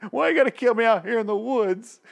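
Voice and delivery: high, whining mock plea, words drawn out; hammed-up begging for his life, pitch way up; pleading. Dwight begs not to be killed in the woods, hammed-up pleading. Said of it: "then he starts pleading".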